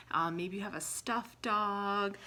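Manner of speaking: ordinary talk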